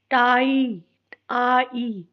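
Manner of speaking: TAI drawn out long on "टाई, आई"; long vowel sound. Shown as a demonstration. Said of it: "long sound will be there".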